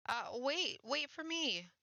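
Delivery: high urgent call, wait for me; calling after her. Acted. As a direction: call out to a girl running away. Mel calls after fleeing girl.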